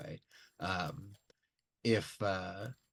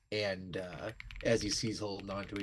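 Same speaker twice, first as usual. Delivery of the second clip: ordinary talk, nothing marked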